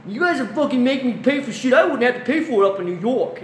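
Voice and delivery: high, loud mocking complaint; mock yelling New Yorker. New Yorker yelling at wife over prices, high mock whine. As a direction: whine it like an angry customer. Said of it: "they actually yell at her".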